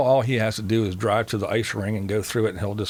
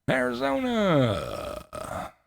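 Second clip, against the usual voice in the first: high, long-held note sliding way down; drawn-out send-off; 13 semitones higher, much slower than usual. Real, not acted. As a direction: stretch the place name out high, then let it fall. Playful send-off to traveling chatter.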